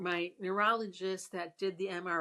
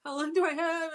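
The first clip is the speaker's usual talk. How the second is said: high, loud, tearful question; loud crying plea to the doctor; retold crying plea; 8 semitones higher, 6 dB louder than usual. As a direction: cry out the question in a panic. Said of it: "I was crying".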